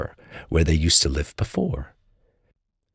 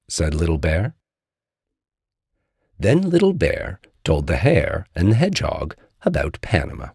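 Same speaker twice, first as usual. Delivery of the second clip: usual speaking voice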